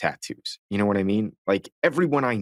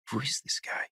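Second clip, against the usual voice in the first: puzzled aside; 3 semitones higher, 10 dB quieter than usual. Retold or imitated. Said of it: "And I'm like".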